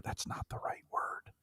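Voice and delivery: soft unvoiced whisper in the ear; hushed correction, barely breathed. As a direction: whisper it like a discreet editor. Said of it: "quietly whisper in your ear".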